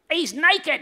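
put-on high, loud British boy's cry; British accent. Little boy shouts at the naked king, British accent. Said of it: "he said it in a British accent".

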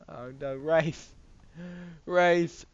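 hammed-up lament; mock dismay. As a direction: call out in pretend distress for the loser. Mock lament for losing player.

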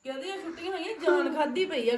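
high-pitched laughing complaint. Real, not acted. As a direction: laugh through the words. Mother laughing about school holidays. (ਹੱਸਦੇ ਹੋਏ)

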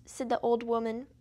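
usual speaking voice

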